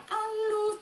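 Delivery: high, dainty "halus"; teasingly delicate high voice; mock-dainty. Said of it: "Halus banget ngomongnya".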